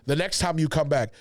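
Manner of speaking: normal talking voice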